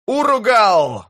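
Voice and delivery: high, drawn-out battle roar; рёв. A narrator's reading. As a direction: roar out a war cry, stretched long. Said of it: "заревел он". (протяжный боевой рёв)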